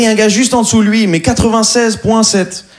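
normal talking voice

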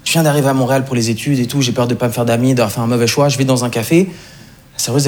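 usual speaking voice

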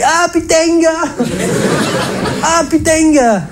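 very high, hammed-up southern French exclamation; Provençal doctor's accent. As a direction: cry out the diagnosis in a broad, high-pitched Provençal accent. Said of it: "son accent chaleureux et rassurant".

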